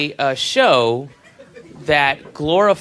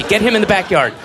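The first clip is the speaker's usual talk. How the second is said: mock urgent orders; 6 semitones higher, 5 dB louder, faster than usual. Mock orders to placate child.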